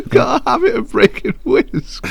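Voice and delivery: quoting the whisk line through high laughter; giggly mock repeat of the odd confession; laughing quote. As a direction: repeat her words while cracking up. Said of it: "That's such a specific thing".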